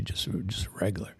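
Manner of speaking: whispered aside in the retold order; whispered request. Telling the bartender he wants regular coffee, whispered. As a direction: drop to a whisper on the last words.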